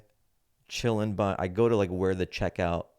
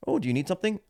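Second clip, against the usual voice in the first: high, eager-to-help clerk imitation; attentive clerk; 10 semitones higher, 3 dB louder, much faster than usual. Clerk offering help off the bat, mock attentive. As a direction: pipe up high as a helpful clerk.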